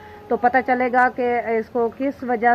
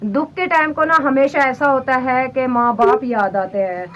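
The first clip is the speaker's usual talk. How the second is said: ordinary talk, nothing marked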